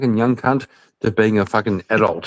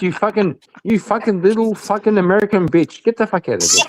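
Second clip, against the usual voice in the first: mock angry rant; 7 semitones higher than usual. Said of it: "Listen to him crying like a little baby".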